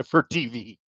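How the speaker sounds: normal talking voice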